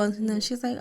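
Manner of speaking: ordinary delivery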